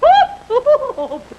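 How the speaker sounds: a high, scornful burst of laughter; mocking cackle. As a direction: cackle at her hope. Stepmother mocks Cinderella's hope.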